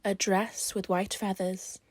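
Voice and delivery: usual speaking voice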